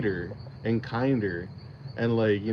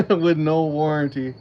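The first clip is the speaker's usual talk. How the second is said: louder, laughing through the words; 5 semitones higher, 6 dB louder than usual. Real, not acted. Repeating the viewer's joke to chat, laughing out loud. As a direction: crack up as you repeat the punchline.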